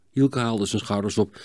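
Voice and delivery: usual speaking voice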